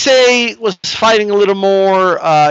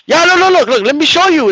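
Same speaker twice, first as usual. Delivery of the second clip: higher, eager imitated worker; 10 semitones higher, 3 dB louder than usual. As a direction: play the eager worker who insists on showing. Worker insisting he show them.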